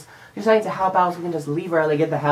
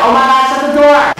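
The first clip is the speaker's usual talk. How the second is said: higher, loud yell at coworkers; panicked shout; 8 semitones higher, 12 dB louder than usual. Retold or imitated. Yelling at new employees to shut the door, panicked shout. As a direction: shout the order in sudden panic. Panicking as shoppers run screaming.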